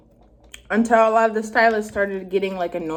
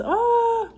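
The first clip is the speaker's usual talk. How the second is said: high excited squeal; 14 semitones higher than usual. Real, not acted. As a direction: squeal with delight before eating. About to eat spicy noodles.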